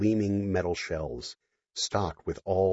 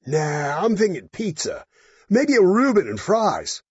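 put-on drunk guy voice; 12 semitones higher, 6 dB louder, slower than usual. Drunk accountant speaking.

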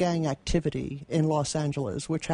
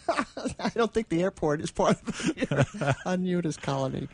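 said through laughter; amused, giggling disbelief; laughing. Real, not acted. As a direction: laugh while you answer. Laughing reply.

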